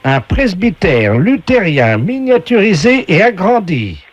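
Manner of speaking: game show announcer. Acted. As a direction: announce each prize with drawn-out flourish. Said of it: "la voix du jeu".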